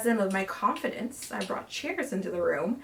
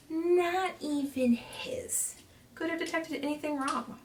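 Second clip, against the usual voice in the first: sly whisper; 7 semitones higher than usual. A narrator's reading. Killer boasting of the hidden corpse, sly whisper. Gloating about the hidden body.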